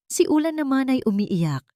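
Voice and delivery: usual speaking voice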